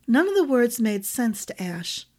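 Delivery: usual speaking voice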